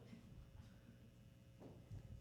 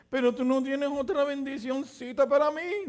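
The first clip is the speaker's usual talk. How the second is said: high, pleading whine for one more blessing; whiny begging Esau; 8 semitones higher than usual. Retold or imitated. Esau begging his father for a blessing, whining high. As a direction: beg like a spoiled son.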